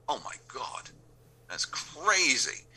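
high-pitched retold astonishment, drawn out; retelling a stunned reaction, slow and high; amazed disbelief. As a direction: stretch it out high in mock amazement. Retold reaction to ratings news.

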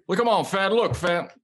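mock Joe Biden snapping back. Friend imitates Joe Biden answering a heckler at a caucus. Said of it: "my Biden voice".

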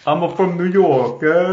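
loud, hammed-up New Yorker; New York accent. Shown as a demonstration. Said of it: "you do a New York ac-, accent".

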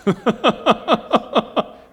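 high, loud staccato laugh; high-pitched burst of laughter. Real, not acted. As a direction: burst out in a rapid ha-ha-ha. Laughs at his own joke.

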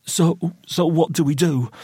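high, breaking half-whisper; frightened, stammered plea; whispered, tearful plea. A narrator's reading. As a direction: ask helplessly through rising sobs. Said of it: "whispered Roy".

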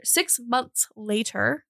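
slow, drawn-out SIX MONTHS LATER. Real, not acted. Narrator correcting her rush through the timeline, slowed down. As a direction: stretch out "Six months later" at half speed. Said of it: "I need to slow down".

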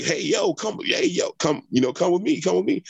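high-pitched mock holler for help; urgent high "over here" call, held back. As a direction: imitate hailing someone from afar, without real volume. Reenacts hailing police from truck.

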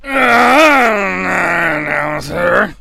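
put-on very slow, groaning drunken slur; Shawn Michaels. Wrestler forcing out his objection, exaggerated effortful slur. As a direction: drag every word out, all rolled together. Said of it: "it sounds like it took so much effort".

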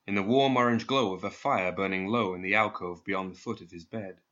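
usual speaking voice

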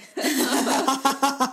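loud, high peal of laughter; hearty laugh. Real, not acted. Co-host teasing about words starting with P, hearty laugh. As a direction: laugh out loud at your own joke. Amused at the P-word joke.

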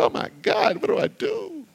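breathless unvoiced gasp rising into a high squeak; a mock panicked whisper that shoots up high; panicked cry. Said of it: "You go".

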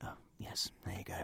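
soft hushed whisper; barely voiced, keep it secret. Passing tea, keeping voices down.

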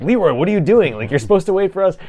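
retold frantic scolding, pitched high; mock exasperation. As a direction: protest like an exasperated gamer, playfully exaggerated. Retold clan's dismay at Leroy.

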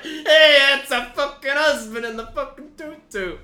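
very loud, high, hammed-up wife; Italian worker's wife. Wife spotting her husband in a tutu, high mock holler. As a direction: holler it as a caricature Italian mom.

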